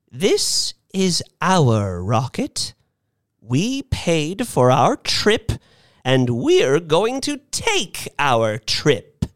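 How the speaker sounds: slow, high, emphatic declaration; resolute old lady. A narrator's reading. Missus Bellows claiming the rocket for everyone, resolute. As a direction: declare it slowly, high, word by word.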